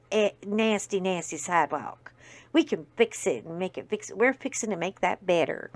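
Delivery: lightly imitated Southern Texan accent; a hint of the Texan mayor's accent. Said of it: "the accent really got screwed up".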